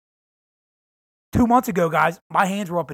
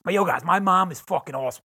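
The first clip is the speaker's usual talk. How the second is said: usual speaking voice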